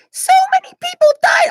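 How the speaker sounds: very high squeaky voice; high-pitched Spider Queen voice. Retold or imitated. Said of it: "I'm just gonna say this normally".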